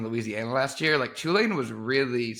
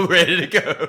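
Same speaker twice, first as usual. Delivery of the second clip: laughing; 3 semitones higher, 6 dB louder than usual. Real, not acted.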